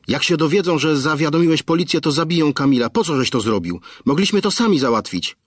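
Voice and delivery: high, fraught wife's voice; reproachful wife. Acted. Wife blaming her husband for calling the police, frantic. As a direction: accuse him in fear for the son.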